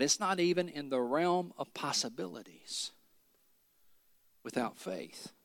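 dropping to a hushed whisper; fading to whisper. Real, not acted. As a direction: let it fall to a whisper.

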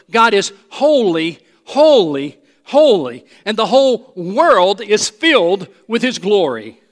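"holy" struck loudly three times, each falling and set apart; emphatic proclamation. Shown as a demonstration. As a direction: declare it forcefully, hammering each "holy". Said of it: "the Bible never emphasizes that".

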